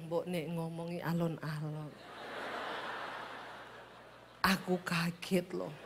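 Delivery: very soft, low, slow Javanese murmur; gentle Javanese husband. Retold or imitated. Startled husband asking wife to speak slowly, soft Javanese accent. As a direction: plead quietly in a Javanese lilt. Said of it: "Dia bilang".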